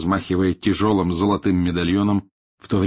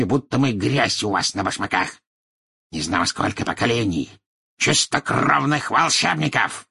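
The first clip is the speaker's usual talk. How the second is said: indignant, then scornfully boastful; proud patriarch, pitch jumping then sinking to disdain; haughty old wizard; 3 semitones higher than usual. Acted. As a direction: put the official in his place.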